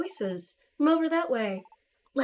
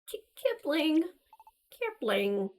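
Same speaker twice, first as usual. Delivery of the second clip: ordinary talk, nothing marked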